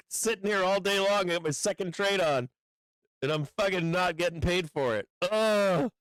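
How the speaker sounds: real aggrieved frustration; exasperated. Real, not acted. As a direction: complain at the unfairness of it. Complains about not getting paid.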